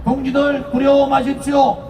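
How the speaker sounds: shouted rally cry, building up; roaring rallying speech. Acted. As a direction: rouse the crowd to chant back. Rally speech, leading a chant.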